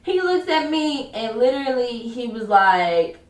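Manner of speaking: ordinary talk, nothing marked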